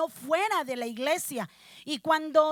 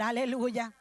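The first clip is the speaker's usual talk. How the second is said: ordinary talk, nothing marked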